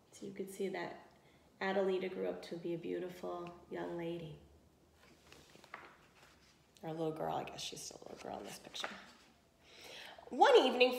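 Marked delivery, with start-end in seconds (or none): 0.1-8.9 dropped to a soft, offhand aside. Real, not acted. Teacher to class, a soft aside about the picture. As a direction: muse quietly about the picture.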